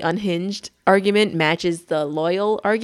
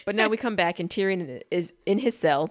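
ordinary delivery

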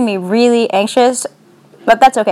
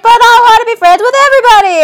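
high, sweet puppy voice; high cheerful dog voice full of love; happy puppy voice; 12 semitones higher, 5 dB louder, faster than usual. Retold or imitated. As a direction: voice the goofy friendly puppy, up high. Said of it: "Dewey's like".

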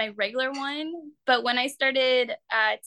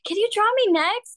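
high, louder, pleading excitement; imitated eager request; 10 semitones higher, 5 dB louder, faster than usual. Artist retells costar asking for a portrait, eager and high. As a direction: ask it like a keen kid, pitched up.

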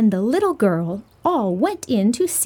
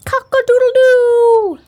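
rooster crow; 13 semitones higher, 8 dB louder than usual. Acted. Rooster answers in story.

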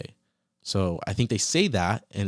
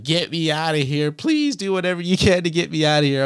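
desperate plea; 8 semitones higher, 6 dB louder than usual. Retold or imitated. Inner plea seeing parents at cell.

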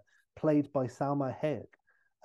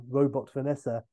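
usual speaking voice

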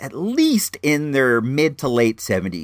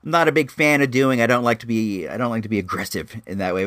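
ordinary talk, nothing marked

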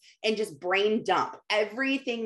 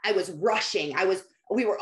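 ordinary delivery

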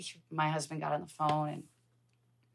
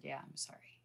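barely a whisper, much softer; whispered apology; 14 dB quieter than usual. Retold or imitated. Husband quietly confirming to his wife, whispered and sorry. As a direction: breathe the apology out gently.

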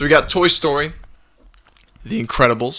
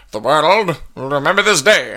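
Oppenheimer voice; 3 semitones higher than usual. Retold or imitated. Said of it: "That's kinda like how Oppenheimer talked".

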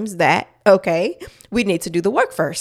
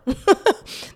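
high laugh; 13 semitones higher than usual. Real, not acted. Laughing over surprising joy.

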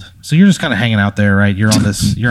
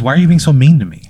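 higher, louder, faster whine; whiny hurt rival; 6 semitones higher, 5 dB louder, faster than usual. Acted. Rival whining at the player, higher and louder. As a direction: whine it like a wounded bully.

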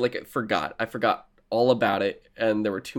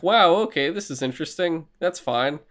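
mock surprised; 6 semitones higher than usual. Viewer's mild reaction.

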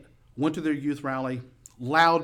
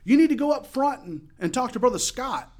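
raised, high voice over loud music; yelling over noise; 11 semitones higher, 5 dB louder than usual. Retold or imitated. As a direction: call it out over the band. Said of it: "having to kinda yell when you talk".

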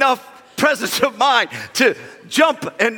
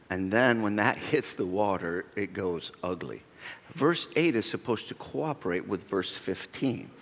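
soft sermon; 12 semitones lower, 10 dB quieter than usual. Acted. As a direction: draw them in, quiet and earnest. Preaching a soft sermon point.

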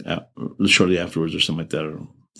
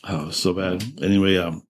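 ordinary talk, nothing marked

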